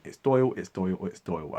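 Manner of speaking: Doyle said with "oy" as in boil; corrected pronunciation Doyle. Shown as a demonstration. Conceding the correction to commenters, Doyle with "oy". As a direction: repeat Doyle, rhyming it with "foil". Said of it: "I would never make that mistake again".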